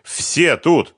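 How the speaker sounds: loud, high exclamation; exasperated. A narrator's reading. Фёдор showing the money to Сухарев, exasperated. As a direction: insist loudly, out of patience.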